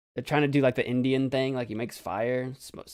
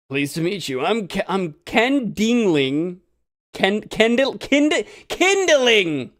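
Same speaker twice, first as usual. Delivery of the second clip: slow, louder attempt at a character voice; picked character voice; 6 semitones higher, 6 dB louder, slower than usual. Acrobat introducing himself to Phoenix, in a picked voice. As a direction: stumble through the name in a put-on voice. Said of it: "I'm gonna pick a voice and go for it".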